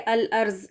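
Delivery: الارض said as "al-arz"; the common z mistake in الارض; mistaken z sound. Shown as a demonstration. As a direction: end الارض on z, not Daad. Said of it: "یہ الارض نہیں پڑھا جاتا".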